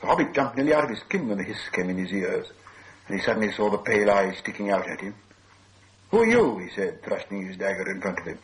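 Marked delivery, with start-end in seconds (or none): 6.1-6.6 loud, high, alarmed cry; startled challenge. A narrator's reading. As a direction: call out sharply, startled by a creature. Startled hobbit challenges hissing creature.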